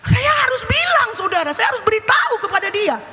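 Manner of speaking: high-pitched, insistent sermon delivery; forceful preaching. Acted. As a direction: press the duty on the congregation urgently. Preaches duty to speak up. (khotbah tegas bernada tinggi)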